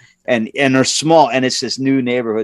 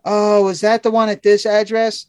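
higher, lightly clueless dispatcher; mimicking dispatcher; 8 semitones higher than usual. The dispatcher checking the job address, lightly higher and airy. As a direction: ask it higher and airy, a hint of mock innocence. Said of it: "I said".

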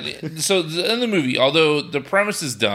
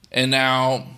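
ordinary talk

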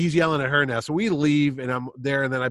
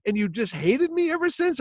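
exasperated Chaykin; 10 semitones higher, 3 dB louder than usual. Retold or imitated.

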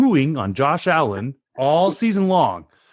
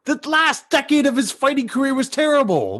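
high-pitched indignant rebuttal; worked-up sports debate; heated exasperation; 10 semitones higher than usual. Real, not acted. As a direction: argue back in exasperated disbelief.

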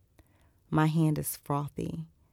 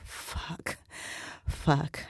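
voiceless, near-silent curse; stunned after the climax, whispered; breathless curse; 6 dB quieter than usual. A narrator's reading. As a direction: breathe the word out with no voice. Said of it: "And I'm like".

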